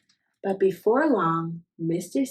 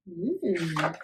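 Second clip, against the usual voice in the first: usual speaking voice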